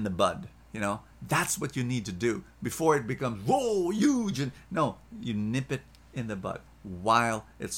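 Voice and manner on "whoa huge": high, loud mock-alarmed outburst; big exclamation at something enormous. Mimics problem grown huge.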